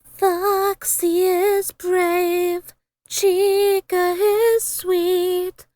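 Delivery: ordinary delivery, nothing marked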